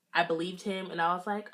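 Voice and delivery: ordinary talk, nothing marked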